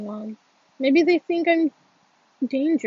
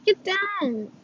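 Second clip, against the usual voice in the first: high urgent shout, louder on the second; crying out; 6 semitones higher, 3 dB louder, slower than usual. Acted. Warning his friend to get down, shouting. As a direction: yell a warning before it's too late. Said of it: "I cry, I cry".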